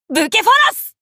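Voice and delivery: 愛馬への呼びかけ. Acted. Calling his horse in attack.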